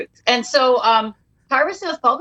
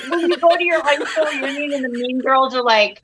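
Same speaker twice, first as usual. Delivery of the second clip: laughing through the words; 4 semitones higher than usual. Real, not acted. Telling the hosts about her reunion, laughing. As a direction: giggle while telling it.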